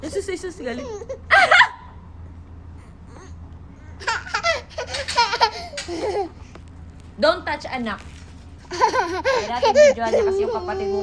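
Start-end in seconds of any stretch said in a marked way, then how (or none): 0.9-7.1 laugh. Real, not acted. Mother laughing at crying child.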